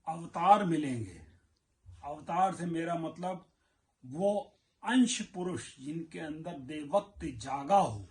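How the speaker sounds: ordinary talk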